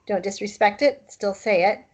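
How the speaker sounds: usual speaking voice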